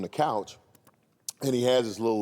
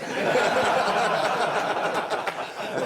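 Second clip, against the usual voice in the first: high rolling laugh; big laugh; 11 semitones higher than usual. Real, not acted. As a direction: laugh out at the story. Laugh after mother's line.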